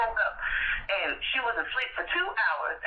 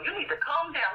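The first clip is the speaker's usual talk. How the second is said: normal talking voice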